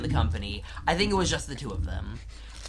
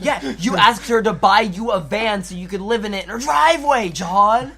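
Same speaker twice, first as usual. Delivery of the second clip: mock comeback; 4 semitones higher, 9 dB louder than usual. Mock comeback to the absent dad.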